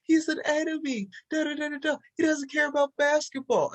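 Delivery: high-pitched mock outrage, whiny parody; hammed-up pearl-clutching in a high voice.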